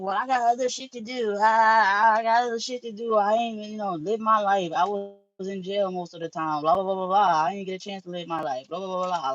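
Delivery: mock whiny partner brushing her off; imitating her partner. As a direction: mimic him waving her off with excuses. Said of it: "he was just like".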